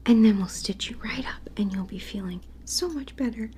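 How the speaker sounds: tender ASMR whisper to a stuffed bear. Acted. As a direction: whisper gently like a toy doctor soothing a patient. ASMR whisper teddy surgery roleplay.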